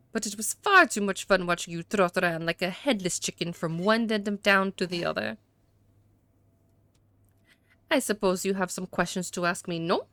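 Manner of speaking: hammed-up French accent for a haughty suspect. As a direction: play a disdainful gentleman with a badly faked French accent. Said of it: "I'm gonna try the French".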